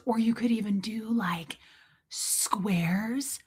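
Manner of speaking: soft whispered suggestion; mom's whisper. Retold or imitated. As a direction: whisper it as a sly extra idea. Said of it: "she does whisper sometimes".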